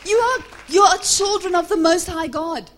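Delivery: high, loud rallying shout; impassioned pulpit shouting; preach at full force. Real, not acted. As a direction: fire up the congregation at full volume.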